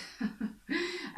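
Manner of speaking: short, soft laugh; a brief, quiet chuckle; light laugh. Real, not acted. Laughs after counting fifteen sub variations.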